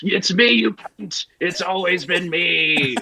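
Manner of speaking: slow, high gloating ghost reveal; mock ghost unmasking itself; slow villain reveal.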